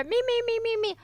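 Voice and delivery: high sung "Me, me, me" like a singer warming up; mock vocal warmup. Streamer imitates a dazed listener, high mock singing. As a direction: squeak out "me me me" way up high. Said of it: "And you go".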